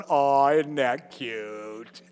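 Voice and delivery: low, soft, slow doting coo; God's fond reply. Retold or imitated. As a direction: coo at a toddler's mistake. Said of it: "God's going".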